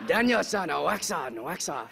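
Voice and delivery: mock Japanese accent, pitch dropping after the name; Karate Kid Japanese accent. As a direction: imitate a movie Japanese accent. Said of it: "I learned from watching Karate Kid".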